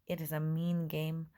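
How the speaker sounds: usual speaking voice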